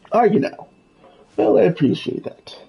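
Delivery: Scrooge voice. Acted. Reading Scrooge's dialogue.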